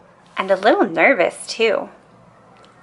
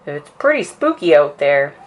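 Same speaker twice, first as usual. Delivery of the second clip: mock spooky papa; 3 semitones lower, 4 dB louder than usual. A narrator's reading. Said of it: "making a scary face at the cubs".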